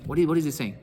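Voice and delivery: normal talking voice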